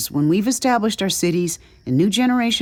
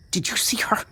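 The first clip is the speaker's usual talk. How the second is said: high-pitched, urgent demand; 5 semitones higher, 4 dB quieter than usual. A narrator's reading. Distraught man questions his companions, demanding. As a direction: demand an answer, voice raised in pitch. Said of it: "Jude demanded".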